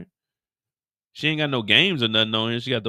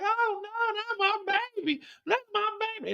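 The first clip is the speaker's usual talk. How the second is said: high, soft mock wail of a frantic mom; distraught mother; 6 dB quieter than usual. Mother over her shot child, high hushed mock wail. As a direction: whimper it way up high. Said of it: "The mama's like".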